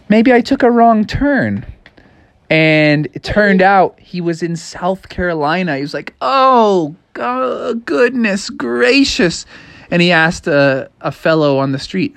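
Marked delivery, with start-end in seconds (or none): 0.1-1.6 higher-pitched wondering character voice; puzzled frog. A narrator's reading. Frog reaching the ocean by mistake, puzzled and higher. Froggy realises he is lost. 6.2-9.5 high, stretched-out astonishment; dismayed exclamation. A narrator's reading. Frog finding himself in South Carolina, dismayed and high. Froggy learns he is far off.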